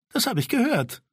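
put-on high, tart reply from a bossy girl; sharp girl's retort. Sina tells Tura she heard him. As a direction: snap back at the little boy.

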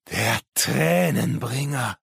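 high, slow, ominous proclamation; fervent madman. Acted. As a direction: draw out the name with crazed awe. Madman reveals the ominous name. (irre und gedehnt verkündend)

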